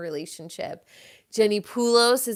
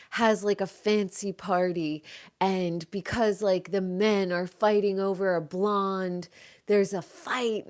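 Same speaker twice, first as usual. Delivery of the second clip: ordinary delivery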